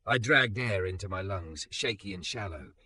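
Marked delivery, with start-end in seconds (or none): none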